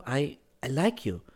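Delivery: falter, then say it simply; hesitant girl. Acted. Girl first admits liking him, hesitantly.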